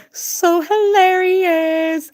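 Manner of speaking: high, drawn-out squeal; amused squeal. Real, not acted. Streamer reacting to a joke, high amused squeal. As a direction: stretch it out slowly in a high voice.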